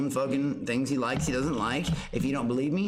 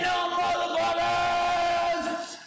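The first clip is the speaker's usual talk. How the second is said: high, slow drawn-out shout; 14 semitones higher, much slower than usual. Real, not acted. Streamer shouts drawn out at the chat. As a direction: belt it long at the chat.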